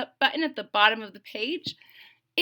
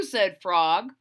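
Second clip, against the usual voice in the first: ordinary delivery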